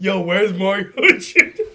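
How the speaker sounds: laughing. Real, not acted. Friend jokes about the rapper, slow and laughing. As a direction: crack up as you ask. Joking about [name].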